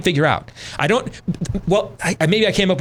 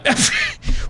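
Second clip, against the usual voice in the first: breathy chuckle; 7 semitones higher than usual. Real, not acted. Host laughs mid-rant.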